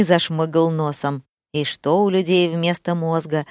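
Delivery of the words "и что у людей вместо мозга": ordinary delivery, nothing marked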